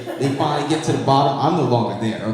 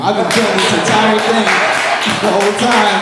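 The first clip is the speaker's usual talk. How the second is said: big loud reveal, higher; punchline shout; 7 semitones higher, 8 dB louder than usual. Acted.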